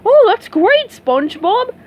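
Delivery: excited cheer. A narrator's reading. Patrick cheering for SpongeBob, happy whoop. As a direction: jump out of bed happy.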